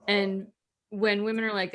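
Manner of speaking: normal talking voice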